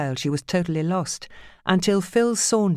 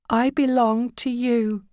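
higher, slow forced reply; submissive woman; 8 semitones higher, slower than usual. A narrator's reading. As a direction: say it obediently, afraid. Judy submitting.

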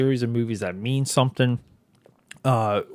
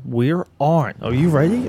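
excited; 5 semitones higher, 5 dB louder than usual. Real, not acted. Hyping up podcast return.

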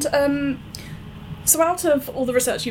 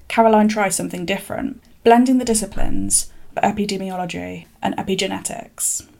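narration read; 3 semitones lower than usual. Acted. Scripted career narration.

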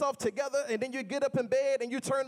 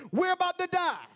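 panicked shout, higher and louder; screaming disciples; 5 semitones higher, 3 dB louder than usual. Retold or imitated. Disciples screaming in Jesus's face, panicked shout. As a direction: cry out in terror at the sleeping teacher. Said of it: "they're screaming in his face".

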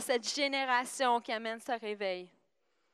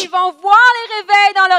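fast, high, shouted interpretation; match the preacher shout for shout; loud, urgent pulpit cry; full-force preaching; 8 semitones higher, 19 dB louder, faster than usual. Real, not acted.

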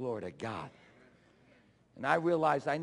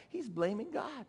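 ordinary talk, nothing marked

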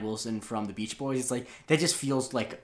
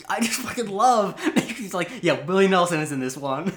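laughing; 8 semitones higher, 7 dB louder than usual. Real, not acted. Laughing while quoting the show.

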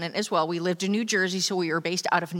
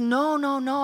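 reassuring brother; 8 semitones higher than usual. Retold or imitated. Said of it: "And he's like".

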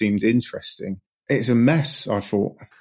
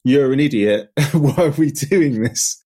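mock exasperated wife; 4 semitones higher, 3 dB louder than usual. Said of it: "flip from".

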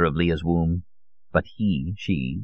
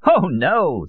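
put-on high, louder, lightly amused old man; amused denial; 10 semitones higher, 5 dB louder than usual. A narrator's reading. Old Solon corrects his visitor's guess, amused. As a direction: wave the guess away with amusement. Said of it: "Solon said, laughing".

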